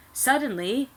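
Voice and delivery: usual speaking voice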